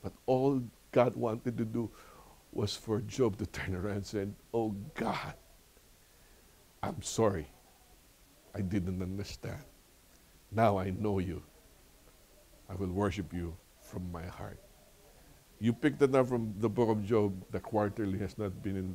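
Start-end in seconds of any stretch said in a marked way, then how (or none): 4.5-14.6 soft, halting confession with long pauses; humble repentance. Retold or imitated. Job turns round to God after suffering, humbly penitent.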